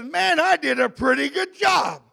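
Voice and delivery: lightly acted self-congratulating God; God pleased with himself. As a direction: play a mildly self-satisfied Creator. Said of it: "He says".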